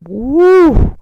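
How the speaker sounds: high loud woo; whoop. Real, not acted. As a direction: let out a whoop. Whoop at joke.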